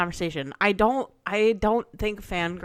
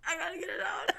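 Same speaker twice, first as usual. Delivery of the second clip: high-pitched, laughing through the words; barely gets it out, cracking up; laughing hard; 13 semitones higher, faster than usual. Real, not acted. Retelling a hilarious dinner story.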